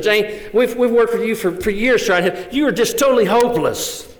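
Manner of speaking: a hammed-up official writing her off; scornful social director. Social director telling Jane she is hopeless, mock scornful.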